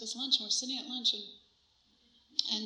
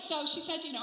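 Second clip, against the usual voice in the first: normal talking voice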